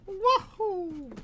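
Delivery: high cartoon Mario cheer; wahoo yell. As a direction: shout it like Mario.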